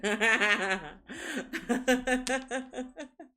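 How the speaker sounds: long high rising laugh; cracking up at being called out. Real, not acted. Laughs at chat call-out.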